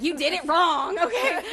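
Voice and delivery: high, loud mock telling-off; hammed-up bossy complaint, laughing; bossy newborn scolding. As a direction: scold mom loudly, pitch climbing. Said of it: "I was telling my mom".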